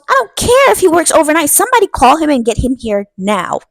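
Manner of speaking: loud, high-pitched order to get him here; commanding shout. Retold or imitated. Dad ordering the girls, in a loud high commanding voice. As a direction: bark the order to get the baby father here now.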